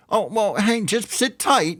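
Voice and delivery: high, sing-song mockery of empty promises; mocking the company's hollow reassurance in a high voice; mocking quote. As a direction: parrot them in a silly high voice. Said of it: "they keep saying".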